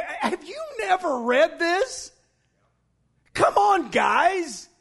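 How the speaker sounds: high, drawn-out exasperated question; can't believe they don't know it; incredulous. Acted. Jesus chiding his listeners as the preacher imagines it, incredulous. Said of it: "that's how he was saying it".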